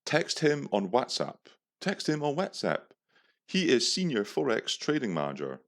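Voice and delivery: lightly voiced scammer reading a text; scammer's voice. Voicing the scammer's text message.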